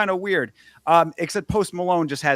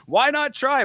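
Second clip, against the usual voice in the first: high, pitched-up announcer pitch; announcer daring the crowd to step in, pitched up; hammed-up announcer; 11 semitones higher than usual. Dares the crowd to fight, high hammed-up announcer. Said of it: "He's like".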